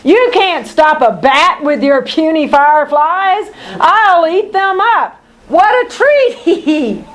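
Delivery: high squealing bat cackle. Acted. Bad Egg Bat taunting the heroes, squealing cackle. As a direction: gloat like a greedy bat.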